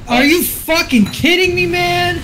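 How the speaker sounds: high, loud exasperated yell; furious disbelief, shout it; angry outburst. Real, not acted. Teammate outraged at game.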